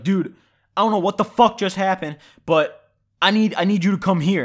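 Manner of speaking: mock panic. Friend phones begging to be picked up, acted-out panic. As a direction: imitate a frantic friend demanding help. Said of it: "He's in, like, a panic".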